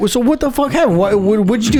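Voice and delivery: high-pitched, bewildered demand; incredulous questioning. Retold or imitated. Tased man questions the officer, incredulous.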